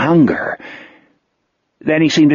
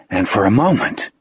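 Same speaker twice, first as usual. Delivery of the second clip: plain reading voice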